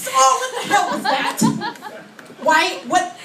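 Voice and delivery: high startled yelp of words; mock pained shock. Acting burned by hot penny.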